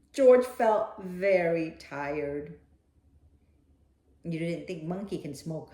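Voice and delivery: plain reading voice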